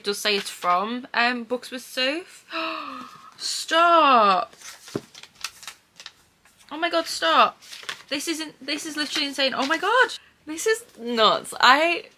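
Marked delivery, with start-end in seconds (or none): 2.5-12.1 high, breathless disbelief; stunned gasp. Real, not acted. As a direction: gasp and squeal in real shock. Overwhelmed by surprise gift.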